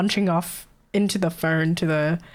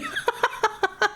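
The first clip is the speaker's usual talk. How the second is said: high breathy giggle; laughing; 14 semitones higher than usual. Real, not acted. Streamer keeps giggling over the rude coworker. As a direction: let the laugh spill out.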